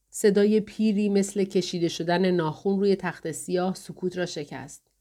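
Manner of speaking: ordinary delivery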